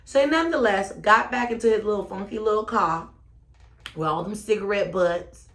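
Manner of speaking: ordinary talk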